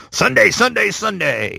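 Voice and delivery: booming monster truck ad announcer; radio announcer voice. Retold or imitated. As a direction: hype it up like a weekend rally ad. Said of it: "like, the radio voice".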